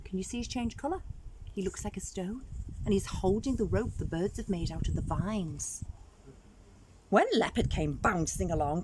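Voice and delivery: ordinary delivery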